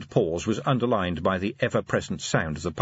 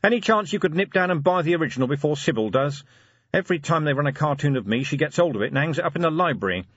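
quick, higher, urgent request; Vimes, exasperated; 7 semitones higher, 3 dB louder, faster than usual. A narrator's reading. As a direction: rattle it off to the butler. Vimes makes a hasty request.

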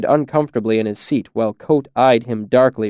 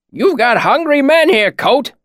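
high, raised-voice hail to the innkeeper; calling out; 13 semitones higher, 4 dB louder than usual. A narrator's reading. Cobb hails the innkeeper as he opens the door, calling out. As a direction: call it out across the tavern, pitched high. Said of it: "Cobb called out".

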